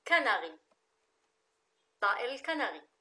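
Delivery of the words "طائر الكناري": usual speaking voice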